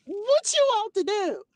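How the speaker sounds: high, slow incredulous question; high slow mock challenge; incredulous quote. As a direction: act out the disbelief. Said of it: "I'll be like".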